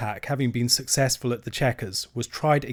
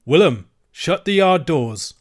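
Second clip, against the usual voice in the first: slow, louder curt order; said shortly; 5 semitones higher, 7 dB louder, slower than usual. Acted. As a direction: close the trap coldly. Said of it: "he said shortly".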